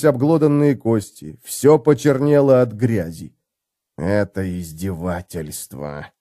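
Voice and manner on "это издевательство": low, slow, breathed out; shaky exhale. A narrator's reading. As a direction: breathe it out trembling. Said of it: "трясясь, выдохнул Хамнер".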